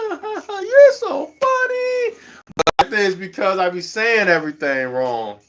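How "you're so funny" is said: very high, drawn-out mock cackle; mock laughing. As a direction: imitate young folks cracking up in falsetto. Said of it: "They be just laughing at me".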